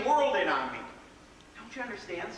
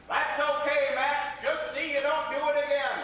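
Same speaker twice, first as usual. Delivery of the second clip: higher, louder crowd shouting back; hollered reply; 8 semitones higher, 4 dB louder than usual. Acted. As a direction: yell it back like half a million people. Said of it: "would holler right back".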